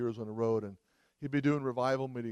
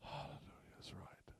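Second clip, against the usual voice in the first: a hushed, breathy-free murmur far softer; whispered hallelujah; 23 dB quieter than usual. Real, not acted. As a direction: drop to a whisper of praise. Whispered aside after loud prayer.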